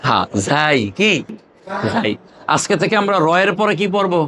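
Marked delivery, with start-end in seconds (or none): 0.0-0.8 ঝাই with a clear final ai glide, not ঝা; correct letter name. Shown as a demonstration. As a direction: say ঝাই as two sounds, ঝা then ই. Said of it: "কোথাও ঝা বললে কেউ চিনবে না".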